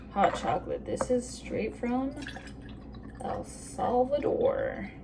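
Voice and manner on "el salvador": El Salvador with an American accent. Shown as a demonstration. Vlogger showing where her chocolate is from, American accent. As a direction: say the country's name the slow American way. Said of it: "say it in an American accent".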